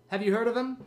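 ordinary talk

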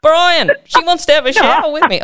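high hammed-up call to Brian; a bloke shouting the news to his mate.

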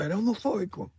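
high, hammed-up accuser; high-pitched mock outrage; mock accusation. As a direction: parody someone quick to take offence. Mocking people crying homophobia.

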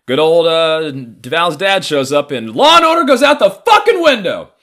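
loud, high exasperated rant; comic outburst. Real, not acted. Telling the audience how dad wrecked the plan, loud and high.

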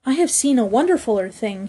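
ordinary delivery

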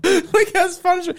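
very high, slow sarcastic "that's fun"; mock delight, drawn out high; mocking the push-up video with a high slow squeal. Real, not acted. Sarcastic reaction.

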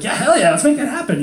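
high, enthusiastic yes; eager cheering-on. Retold or imitated. Backing someone's plans, eager and excited. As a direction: jump in with excited encouragement.

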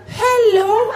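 squeaky high-pitched spooky demon. Acted. Comedian voicing the demon that taunts him, high and eerie. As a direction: call out like a tiny high demon.

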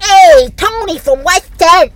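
loud, high, drawn-out caricature; mock unwanted voice. Host mocking how a date should not sound, loud caricature. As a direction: exaggerate a guy announcing himself, slow and loud. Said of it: "I don't want you to sound like".

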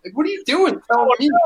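high, needling taunt; mock taunt. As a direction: tease him from way above. Retold taunting of short heckler.

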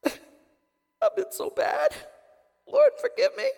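high, loud hammed-up wail; mock crying. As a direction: blubber like a gangster repenting in tears. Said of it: "they're all crying. They're like".

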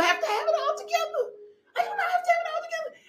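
high, breaking laugh while speaking; laughing through tears. Real, not acted. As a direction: let the laugh crack into tears.

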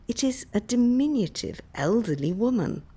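ordinary delivery, nothing marked

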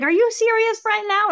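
very high-pitched incredulous friend; retold disbelief. Friend to the storyteller washing stones, mock incredulous. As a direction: imitate a friend who can't believe it.

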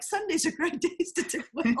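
high teasing voice breaking into a laugh. Retold or imitated. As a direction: tease the father-in-law with his own saying. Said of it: "I'm gonna be like".

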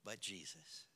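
low, very soft, almost under the breath; soft, low and understated; hushed statement. Shown as a demonstration. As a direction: drop it quietly into the silence.

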